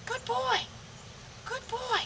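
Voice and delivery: sing-song praise to the dog; high praise voice. Real, not acted.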